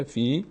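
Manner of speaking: "فی" drawn out as "fee"; long "ee". Shown as a demonstration. Teacher shows kasra plus ya, long "ee". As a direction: hold a pure "ee" on "فی".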